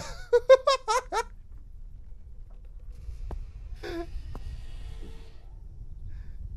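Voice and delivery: high airy wheezing laugh; breathless nervous laugh. Real, not acted. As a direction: gasp out laughter after a fright. Breathless laugh after scare. (rire nerveux et essoufflé)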